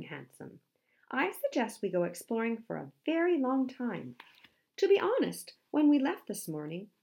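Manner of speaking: excited storybook explorer; Dwayne the polar bear, delighted. Acted. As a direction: gush with enthusiasm for the outing.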